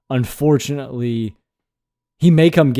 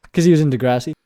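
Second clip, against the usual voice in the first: laughing; faster than usual. Real, not acted.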